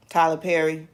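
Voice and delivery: usual speaking voice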